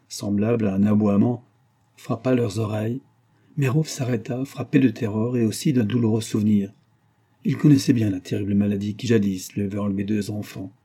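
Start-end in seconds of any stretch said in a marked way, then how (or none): none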